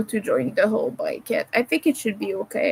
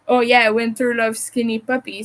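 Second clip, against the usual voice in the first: ordinary talk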